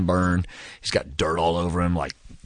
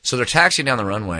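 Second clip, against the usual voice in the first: ordinary talk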